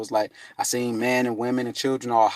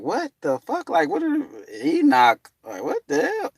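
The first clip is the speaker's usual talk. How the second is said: high, rising incredulous cry; can't believe what you hear; stunned disbelief; 13 semitones higher than usual. Retold or imitated. Man stunned at being called Enoch, incredulous.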